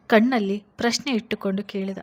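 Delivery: plain reading voice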